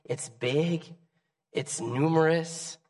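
soft, tender voice of God. Acted. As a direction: speak gently as a loving God. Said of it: "God said".

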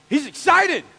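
loud, high burst of enthusiasm; excited. Real, not acted. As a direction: belt it out, thrilled. Preacher hypes up Moses's joy.

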